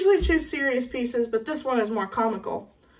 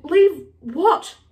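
raised, louder puzzled question; bewildered; 5 semitones higher, 5 dB louder than usual. A narrator's reading. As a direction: ask in utter confusion, pitched up. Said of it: "Harry said, bewildered".

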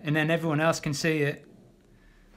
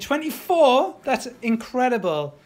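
surprised; 6 semitones higher, 4 dB louder than usual. Real, not acted. Reacts to a viewer comment.

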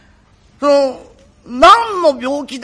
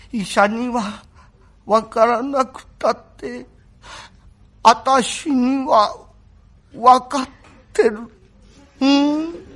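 very slow, halting sick voice; feeble invalid; 3 semitones higher, much slower than usual. Acted. The sick young master weakly telling Kuma he knows his illness. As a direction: drag each word out weakly.

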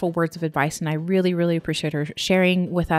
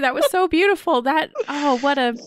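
choked with real emotion, pushing through; welling up as she praises the words; choking up; 8 semitones higher, 6 dB louder than usual. Real, not acted. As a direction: voice tight and high, fighting back tears. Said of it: "I'm choking up".

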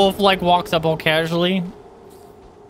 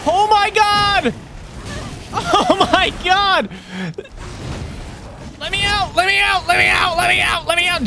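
panicked yelling; 11 semitones higher than usual. Real, not acted. Gamer trapped by enemies, screaming in panic. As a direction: scream to be let out.

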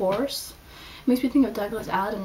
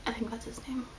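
whispered aside; 3 semitones higher, 7 dB quieter than usual. Real, not acted. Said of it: "whispered aside".

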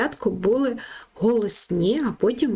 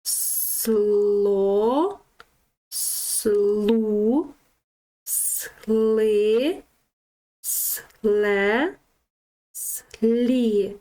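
протяжно, з довгою голосною; тягни кожен склад, не називаючи букв; злите читання складів; much slower than usual. Shown as a demonstration. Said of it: "будемо зразу пробувати читати". (протяжне читання по складах)

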